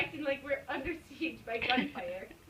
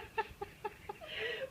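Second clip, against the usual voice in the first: high giggle; 13 semitones higher, 7 dB quieter than usual. Real, not acted. Laughing fit while reading.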